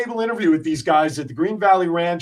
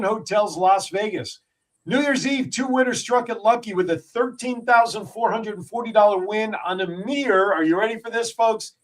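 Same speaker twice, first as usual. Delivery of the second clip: higher-pitched, punchy story read; news read; 5 semitones higher than usual. Acted. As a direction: read the jackpot news out like a radio host. Reads a news item aloud.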